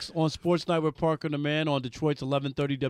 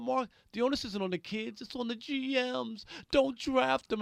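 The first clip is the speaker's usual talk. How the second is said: ordinary talk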